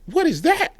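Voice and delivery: squeaky high mock puzzled what is that. Puzzling over a Rubik's Cube of data, mock surprised. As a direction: act baffled by the twisted cube, high and surprised. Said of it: "start twisting it again".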